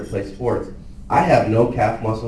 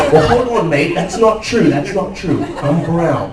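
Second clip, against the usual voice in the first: high, loud Australian protest; Australian accent, high and laughing; Steve Irwin voice; 7 semitones higher, 7 dB louder than usual. Retold or imitated. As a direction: insist like an indignant crocodile hunter, laughing. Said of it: "you're doing a Steve Irwin impersonation".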